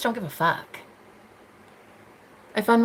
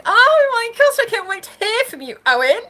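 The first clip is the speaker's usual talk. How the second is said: excited squeal; 12 semitones higher, 6 dB louder than usual. Real, not acted. Reading a fan's comment, squealing with delight. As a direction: gush over the viewer's name.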